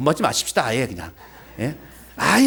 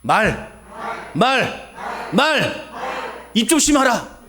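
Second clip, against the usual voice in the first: raised, insistent second call; emphatic repeat; 6 semitones higher, much slower than usual. Shown as a demonstration. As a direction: push the congregation to answer up. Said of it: "다시".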